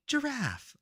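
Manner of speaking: lady saying giraffe. Retold or imitated. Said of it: "which is a lady saying".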